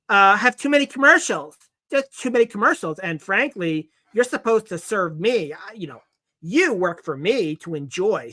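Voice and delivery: lightly voiced complaining viewer; mock entitled commenter. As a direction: sneer it like a spoiled commenter. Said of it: "number one".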